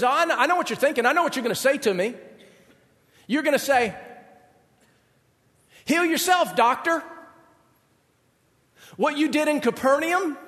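mock hometown crowd. Jesus voicing his hometown's demands.

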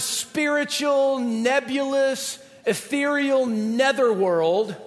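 slow, drawn-out dreamy words; floaty, mocking slow delivery; mock ethereal. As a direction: stretch each word out as if drifting. Mocking vague dreamy afterlife.

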